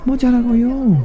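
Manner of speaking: very high eager squeak; very high excited mouse; mouse exclaiming. Acted. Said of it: "exclaimed the mouse".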